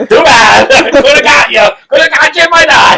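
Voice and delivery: high, loud taunt through laughter; laughing taunt. Real, not acted. Laughing at the friend, shouting a taunt between laughs. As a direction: tease him while cracking up.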